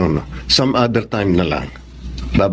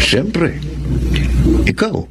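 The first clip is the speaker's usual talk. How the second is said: playful, sing-song and much higher on ikaw; teasing sweet talk; 8 semitones higher, slower than usual. Acted. Suitor teasing the woman he courts, playful and much higher. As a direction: flirt it up, tease her.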